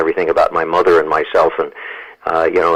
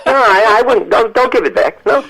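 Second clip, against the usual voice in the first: put-on high, old Yiddish-accented mother refusing; imitating his mother. Son voicing his mother refusing to return the check, high Yiddish accent. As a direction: pitch way up into his mom's heavy Yiddish voice. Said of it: "That's exactly the way she said it".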